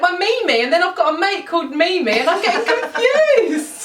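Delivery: high, fast, laughing. Real, not acted. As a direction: squeal it out giggling. Laughing while telling embarrassing story.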